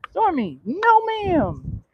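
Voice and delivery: high, drawn-up "no ma'am"; scolding the dog. Real, not acted.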